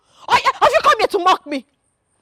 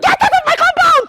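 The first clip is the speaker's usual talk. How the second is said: high furious shout; shouted order; 8 semitones higher than usual. Acted. Wife ordering the man out, furious shout. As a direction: throw him out with your voice.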